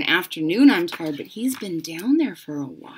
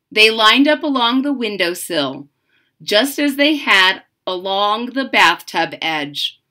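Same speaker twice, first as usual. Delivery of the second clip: put-on lively read-aloud voice for children; story narration; 7 dB louder than usual. A narrator's reading. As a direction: read it like a bedtime picture book. Reads the picture book aloud.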